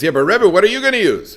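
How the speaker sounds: high, loud acted objection; worried protest. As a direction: protest to the Rebbe, pitch jumping up.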